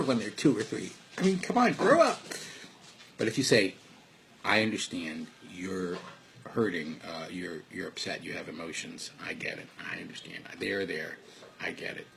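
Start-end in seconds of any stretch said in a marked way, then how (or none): 1.2-2.2 incredulous mock scolding, rising higher; mock exasperation. Mocks scolding a toddler as immature. 4.4-12.1 soft, low, reassuring parent; lowered, tender acknowledgement; soothing understanding. Acted. Voices a parent soothing upset child.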